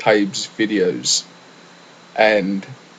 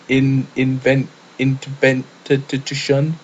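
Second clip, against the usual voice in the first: halting, stumbling, repeated false starts; stuttering; 2 semitones higher, 3 dB louder, slower than usual. Retold or imitated. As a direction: stumble over the words, searching. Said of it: "I was just trying to show you how he was saying it".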